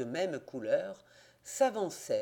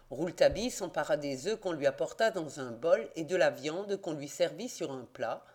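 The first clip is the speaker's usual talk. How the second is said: ordinary delivery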